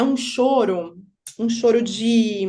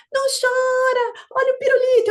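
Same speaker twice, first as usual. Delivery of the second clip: put-on high-pitched coaxing of a crying child; mock baby-talk coaxing; imitated fussing strangers; 5 dB louder than usual.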